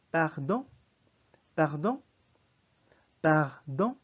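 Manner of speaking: loud "Pardon" with a nasal end like a short NG; French pronunciation. Shown as a demonstration. Teacher models "Pardon" for repeating, loud, rising, nasal ending. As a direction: lift the nasal last syllable of French "Pardon". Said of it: "Notice that the sound at the end".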